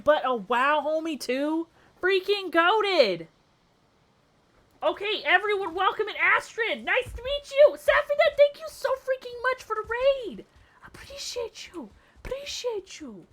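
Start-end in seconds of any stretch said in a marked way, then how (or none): 2.0-3.3 slow, high, drawn-out "goated"; excited praise. Real, not acted. Streamer hyping a fellow Valheim player, slow and high. 4.8-10.4 very high, squealy greeting; excited welcome. Real, not acted. Streamer welcoming Astrid and thanking the raider, very high. 10.9-13.3 high, breathy whisper of "Appreciate you!"; whispered thanks. Real, not acted. Streamer whispering thanks to the raider.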